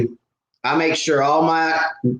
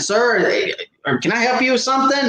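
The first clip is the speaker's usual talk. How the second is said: sarcastic politeness; 8 semitones higher than usual. Retold or imitated. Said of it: "he kinda caught the sarcasm".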